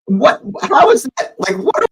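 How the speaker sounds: can't believe what he just saw; stunned disbelief. Real, not acted. Fan reacting to the referee, stunned high disbelief.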